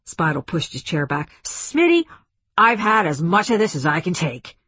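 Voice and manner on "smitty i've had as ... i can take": fed-up, raised-voice complaint; higher, louder exasperation; exasperated. A narrator's reading. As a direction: announce that patience has run out. Fed-up general gets up to leave.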